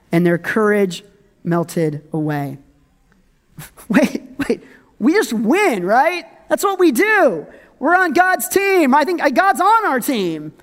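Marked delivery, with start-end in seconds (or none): 3.9-10.5 high-pitched, baffled protest; mock disbelief. Israelites stunned that God's team lost, mock disbelief. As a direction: act out confused team members who expected to win.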